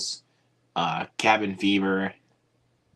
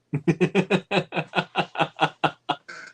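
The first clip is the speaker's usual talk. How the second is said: high laugh; 10 semitones higher than usual. Real, not acted. Laughing at own accent.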